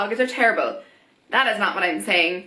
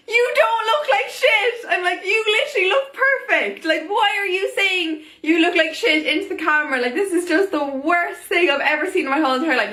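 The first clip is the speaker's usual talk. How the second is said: much higher, incredulous outburst with a laugh in it; exasperated disbelief; 7 semitones higher than usual. Acted. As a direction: plead with the screen in disbelief. Exasperated at blogger apologizing.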